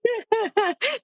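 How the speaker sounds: short high burst of laughter. Real, not acted. Listener laughs at story.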